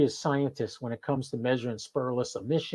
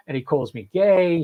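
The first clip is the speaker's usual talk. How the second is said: normal talking voice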